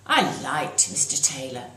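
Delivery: ordinary delivery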